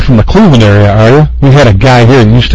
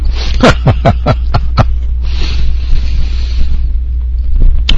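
breathy laugh; 5 dB quieter than usual. Real, not acted. Laughing with food in mouth.

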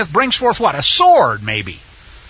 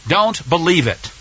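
normal talking voice

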